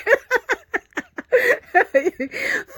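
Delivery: high amused laugh; giggle. Real, not acted. Laughing at her own song interruption, high giggle. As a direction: laugh at yourself.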